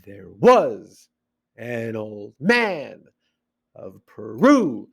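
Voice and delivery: slow-motion limerick stresses on WAS, MAN, RU; exaggerated metrical stress. Shown as a demonstration. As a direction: break it into slow beats, swelling on was, man, Peru. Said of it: "So even parts of words come together".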